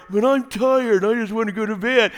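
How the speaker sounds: husband whining back at his wife; whiny husband. Retold or imitated. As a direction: complain like a worn-out kid.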